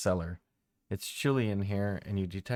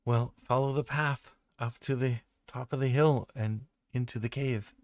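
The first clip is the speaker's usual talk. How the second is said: airy tree voice; 4 semitones higher than usual. Acted. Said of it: "wispy kind of ... windy kind of voice".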